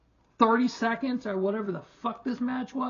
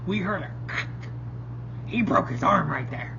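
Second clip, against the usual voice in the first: hushed, mocking commentator whisper. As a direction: whisper it like a fake dramatic call. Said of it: "That could not have sounded more fake".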